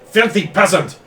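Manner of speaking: high, loud outraged shouting; raging noble. Acted. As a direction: rage at the foreign dog through the translator. Noble raging through a translator.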